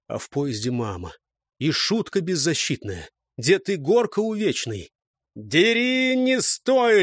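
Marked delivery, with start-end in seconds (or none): 5.3-7.0 high, urgent battle command; barked order. A narrator's reading. Gusli drive the running squad forward, barked order. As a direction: bark it to drive them on. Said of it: "рявкнули гусли".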